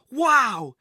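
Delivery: put-on loud, high "wow" from a thrilled boy; excited exclamation. A narrator's reading. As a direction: exclaim it with amazed glee. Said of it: "he exclaimed".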